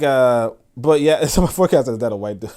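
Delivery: ordinary delivery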